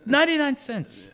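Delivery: movie quote. Retold or imitated. Quoting movie line.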